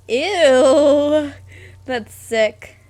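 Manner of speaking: disgusted cry then a loud groan; grossed-out shriek. Real, not acted. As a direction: squeal like you touched something slimy. Reacting to gross description.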